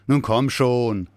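loud, drawn-out call; calling back. A narrator's reading. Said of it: "rief er nach hinten in den Gang". (laut nach hinten gerufen)

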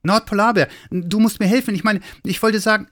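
high, flustered little Santa; breathless high-pitched plea; out of breath. A narrator's reading. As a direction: blurt it out excitedly, short of breath. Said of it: "bist ja völlig außer Atem".

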